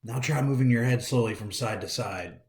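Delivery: ordinary delivery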